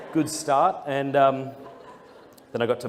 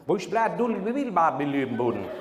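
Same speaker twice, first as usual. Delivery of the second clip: mock thick Irish accent in made-up gibberish, laughing; 3 semitones higher than usual. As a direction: babble like a thick Irish accent you can't follow. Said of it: "That's what it sounded like to me".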